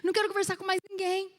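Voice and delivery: high, upset mock wail; distraught retold cry; mock tearful plea. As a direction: imitate her crying out in distress. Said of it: "Eu falei".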